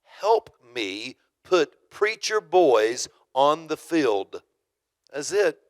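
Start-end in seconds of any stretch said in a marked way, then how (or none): 0.0-4.4 slow, louder, every word of the slogan weighed; proclaimed slogan. Retold or imitated. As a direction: announce it like a banner slogan. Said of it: "This is what it was".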